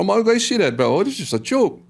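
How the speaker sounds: high, loud incredulous challenge; mock taunt. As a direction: throw it out like a jeer. Acting out the warriors' taunt.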